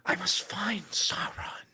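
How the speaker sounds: slow, high, airy elf whisper; breathy whisper. Retold or imitated. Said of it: "Oh, they all whisper".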